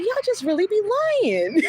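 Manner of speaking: exasperated. Retold or imitated. Exasperated retort to clerk.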